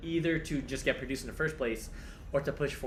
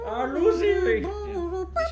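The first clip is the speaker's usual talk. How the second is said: excited squeal; 9 dB louder, slower than usual. Real, not acted. Gushing over favorite show.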